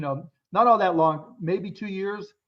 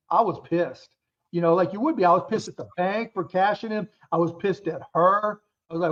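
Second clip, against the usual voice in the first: normal talking voice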